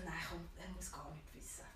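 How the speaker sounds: hushed unvoiced whisper, secretive; whispered to herself. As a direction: whisper it as if nobody may hear. Telling herself to keep it secret.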